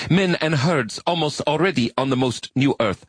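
high alien voice reciting proudly; earnest arachnid recital. Acted. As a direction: read it solemnly, high-pitched. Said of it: "Your translation is not idiomatic".

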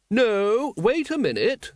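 high-pitched, sudden doubt; high, drawn-out objection; lady interrupting. A narrator's reading. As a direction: cut in as a well-bred lady with a hunch. Lady Ramkin interrupts on realising something.

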